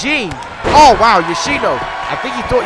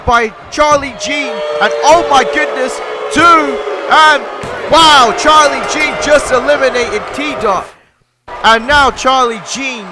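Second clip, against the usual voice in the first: excited call; 5 semitones higher, 3 dB louder than usual. Real, not acted. Commentator calling Charlie Gene's elimination, shouting high. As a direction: sell the elimination at full voice.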